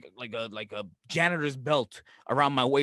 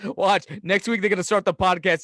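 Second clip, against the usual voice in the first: ordinary talk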